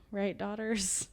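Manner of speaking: normal talking voice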